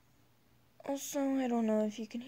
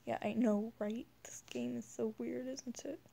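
usual speaking voice

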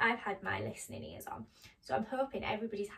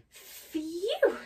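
higher-pitched storybook sigh; sigh of relief; 6 semitones higher, 6 dB louder than usual. Acted. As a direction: let it out as a relieved mouse would. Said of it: "sighed Squeak".

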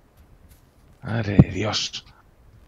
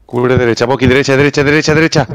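urgent callout, building louder and higher; cover me shout; 4 semitones higher, 5 dB louder, faster than usual. Real, not acted. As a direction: yell the direction with rising urgency. Urgent callout during firefight.

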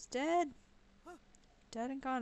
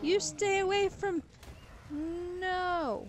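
yelling; 9 semitones higher, 4 dB louder, much slower than usual. Real, not acted. Yelling at attacking creature.

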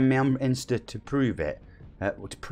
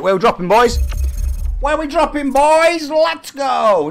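loud, high hype yell to the squad; hyped call out; 12 semitones higher, 7 dB louder, slower than usual. Real, not acted. Streamer shouting to his squad before the battle bus drop, loud hype.

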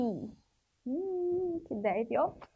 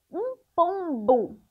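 slow teaching demo; 3 semitones higher, 5 dB louder, slower than usual. Shown as a demonstration. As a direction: slowly spell out the sound of pombo. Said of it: "Dá pra perceber uma diferença no som do pombo".

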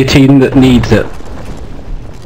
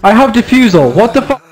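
higher, exasperated yelling; frustrated outburst; 7 semitones higher than usual. Real, not acted. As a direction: blow up in frustration. Frustrated outburst during game.

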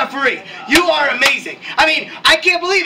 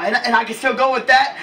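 ordinary delivery

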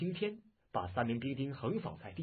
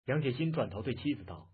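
ordinary delivery, nothing marked

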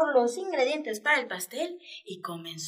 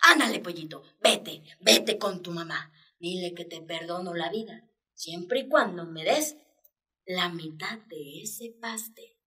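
sly bald cat; 6 semitones lower than usual. Acted. Bald cat spares the chick for half the cake, low and sly. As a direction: bargain like a greedy cat. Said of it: "pensó".

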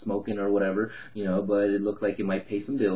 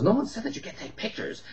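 snide, much higher mocking voice; smart-ass retort; 13 semitones higher than usual. Tenant being a smart ass to the investor, snide high mock voice. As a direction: sneer it back like a wiseguy. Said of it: "really smart ass with me".

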